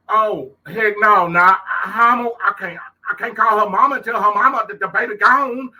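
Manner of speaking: high, loud frantic thoughts; panicked inner voice. Retold or imitated. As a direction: race through it in rising panic. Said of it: "I'm thinking like".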